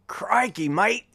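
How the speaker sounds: high-pitched, hammed-up Australian accent. Host imitating Steve Irwin for viewers, bad Australian accent. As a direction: do an amateur, excitable Aussie Steve Irwin imitation. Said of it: "emulate that accent".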